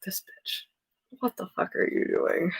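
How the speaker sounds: a hushed, scornful whisper; whispered mock guides. Reader voices her spirit guides whispering about her. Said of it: "they're probably just like".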